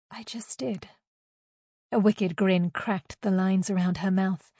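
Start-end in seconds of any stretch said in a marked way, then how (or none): 0.1-0.9 soft, airy taunt; higher and hushed, savouring the power; smug near-whisper. A narrator's reading. Superior gloats over the demotion.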